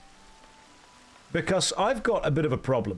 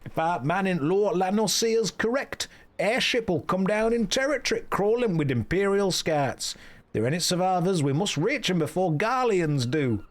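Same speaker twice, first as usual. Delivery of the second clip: put-on officer in a drifting Yorkshire accent, pitched up; wandering Yorkshire accent; 5 semitones higher than usual. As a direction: give orders in an unsteady Yorkshire dialect. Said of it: "His accent has changed as this has gone on".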